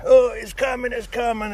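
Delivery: high, loud squeal of alarm; whiny Duncan. Retold or imitated. Buddy farting in the bank, high and loud.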